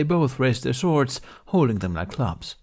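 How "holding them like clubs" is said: ordinary delivery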